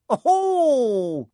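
high, loud gleeful cackle; evil cackle. Acted. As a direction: cackle like a wicked poltergeist. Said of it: "he said with an evil cackle".

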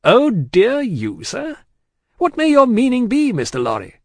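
high, loud, indignant demand; big man taking offence; affronted challenge. A narrator's reading. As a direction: draw out the echo, then demand an explanation. Affronted Stryver challenges Lorry.

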